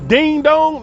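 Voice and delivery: high, loud sneering "Ding dong"; loud high mocking jab. Real, not acted. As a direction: call him an idiot with a loud singsong jeer. Mocking the senator.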